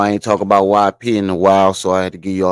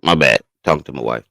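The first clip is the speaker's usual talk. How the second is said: usual speaking voice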